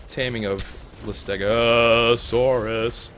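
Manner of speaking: Listegosaurus stretched slowly with weight on the opening; drawn out stress. Shown as a demonstration. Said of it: "Emphasis on the uh".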